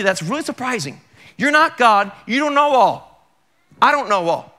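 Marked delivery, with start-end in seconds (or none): 1.4-4.5 louder, higher insistence; emphatic repeat. Real, not acted. Preacher pressing the congregation, loud insistence. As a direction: drive the point home.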